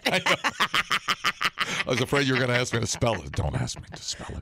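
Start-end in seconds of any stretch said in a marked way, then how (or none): 3.3-4.4 mock dread. Dreads being asked to spell.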